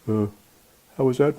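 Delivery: normal talking voice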